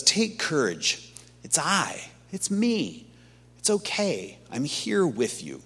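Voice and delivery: slow, soft and tender; Jesus softly reassuring his frightened disciples; tender reassurance. Acted. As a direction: hush them gently like a comforting friend.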